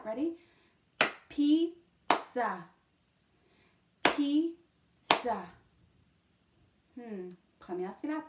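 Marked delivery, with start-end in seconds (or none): 1.3-5.5 "Pizza" split with a long gap, high Piz, then za; syllable by syllable. Shown as a demonstration. Teacher leading syllables for spelling, "Pizza" in two beats. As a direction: break "Pizza" into two separate, higher-pitched beats. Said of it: "on va faire les syllabes".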